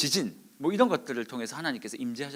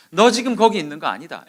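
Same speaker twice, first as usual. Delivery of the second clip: loud, raised command; forceful rebuke; 4 semitones higher, 11 dB louder than usual. Retold or imitated. Preacher as God reproving Elijah, forceful. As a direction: thunder it as a reprimand. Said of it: "엘리야에게 강하게 말씀하셨어요".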